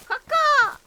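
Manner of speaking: high, loud crow-like caw; bird caw. Retold or imitated. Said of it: "Doesn't he sound like a bird?".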